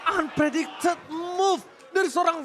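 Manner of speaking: excited. Real, not acted. Caster shouts surprise play.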